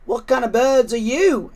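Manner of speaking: a suspicious pigeon asking the flying cats; curious pigeon. Acted. Pigeon asking the flying kittens, wary.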